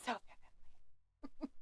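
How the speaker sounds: soft breathy whisper, slowed; hushed, dreamy whisper; whispered echo. Shown as a demonstration. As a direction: whisper it back, savouring it. Echoing the chat line.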